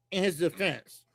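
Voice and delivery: ordinary talk, nothing marked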